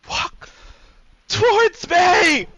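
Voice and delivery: first word held back, then a high, drawn-out scream; tearful scream. Acted. Weeping man repeating his order to her, tearful scream. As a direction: scream it through tears. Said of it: "he's gonna say it again, tears rolling down his eyes".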